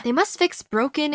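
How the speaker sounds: usual speaking voice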